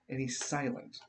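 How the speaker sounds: normal talking voice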